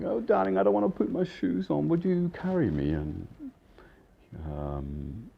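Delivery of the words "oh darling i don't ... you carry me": much higher, sing-song spoiled darling voice; coquettish woman. Retold or imitated. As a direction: wheedle him like a pampered lady. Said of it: "she's like".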